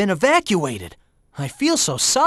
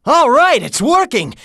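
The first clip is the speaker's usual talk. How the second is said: loud, higher-pitched cheer; excited cheer; 4 semitones higher, 4 dB louder than usual. Acted. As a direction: celebrate a first success. Attack starts working.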